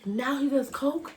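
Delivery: ordinary delivery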